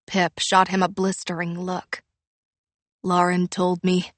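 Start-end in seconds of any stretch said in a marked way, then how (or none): none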